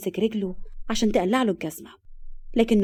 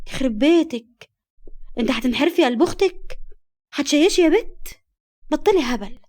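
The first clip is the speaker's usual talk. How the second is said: mock-outraged scolding, pitched high and louder; playful scolding; 5 semitones higher, 4 dB louder than usual. Friend hears her ask for shisha, scolding in shock.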